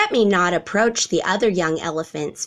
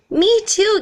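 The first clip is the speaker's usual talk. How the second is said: high, excited little giraffe gasp; eager giraffe child gasping in surprise; 5 dB louder than usual. A narrator's reading. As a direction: blurt it out much higher and louder, delighted. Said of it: "gasped Cherry".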